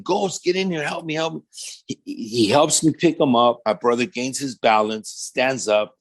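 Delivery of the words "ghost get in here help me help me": high, urgent plea for help; calling for help. Retold or imitated. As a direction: call out high in a panic. Calling for help, brother collapsed.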